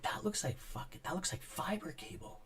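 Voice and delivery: quiet wondering aside to himself; hushed realisation. Retold or imitated. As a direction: say it half under breath, amazed. Retold surprise, hushed.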